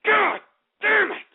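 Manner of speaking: high, exasperated outburst; angry curse. Real, not acted. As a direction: curse in real fury. Rage at the callers.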